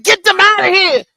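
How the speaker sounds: woman in labor ordering nurse; mock demand. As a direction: order the family out. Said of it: "I said".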